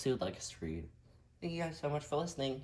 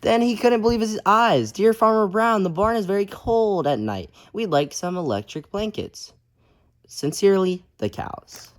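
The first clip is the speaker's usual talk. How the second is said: lively, loud storybook reading; reading to children; 13 dB louder, slower than usual. A narrator's reading. Teacher reading a picture book aloud to kids, lively. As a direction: read it out big for the kids.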